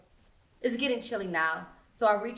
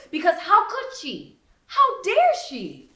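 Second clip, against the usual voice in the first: how dare she, pitched up; outraged disbelief; 9 semitones higher, 7 dB louder than usual. Acted. Narrator outraged at betrayal.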